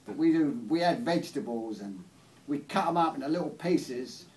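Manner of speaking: loud cowboy-country waitress explaining the dish; Oklahoma waitress. Acted. Waitress leaning in to explain omelettes to an English customer, loud role. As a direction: play the local waitress, loudly spelling out the recipe. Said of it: "She says, she leans, leans forward".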